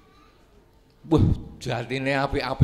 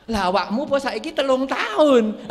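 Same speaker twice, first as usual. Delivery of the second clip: high, louder sharp rebuke; stern father retort; 10 semitones higher, 4 dB louder than usual. Acted. Father scolding his grown son, high and sharp. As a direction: throw the question back hard.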